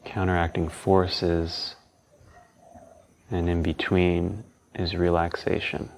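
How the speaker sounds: calm, unhurried guiding tone; guided meditation. Acted.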